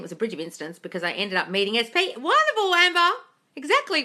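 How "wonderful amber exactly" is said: very high, loud gushing squeal; delighted squeal. Real, not acted. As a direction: gush with delight at the good news. Delighted reaction to viewer's good news.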